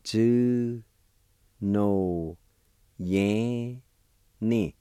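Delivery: Tsu, no, yan, ni each said as a separate syllable with pauses. Shown as a demonstration. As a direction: break Tsu no yan ni into clear, slow syllables. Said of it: "it's pronounced like this".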